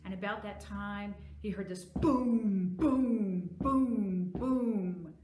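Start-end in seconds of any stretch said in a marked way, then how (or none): 1.8-5.1 loud, drawn-out booms, each one falling; booming footsteps. Acted. Storyteller imitating the giant's footsteps for children, booming loudly. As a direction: boom it out like a giant's heavy tread.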